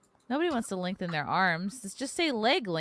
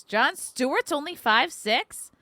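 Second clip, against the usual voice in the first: slow, stunned disbelief; drawn-out shocked question; surprised; 6 semitones higher, 5 dB louder, slower than usual. Real, not acted. Reading celebrity heights, shocked.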